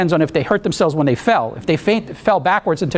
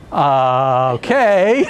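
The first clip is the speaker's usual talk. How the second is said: laughing uncertainty; 2 semitones lower, much slower than usual. Retold or imitated. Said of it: "And I said".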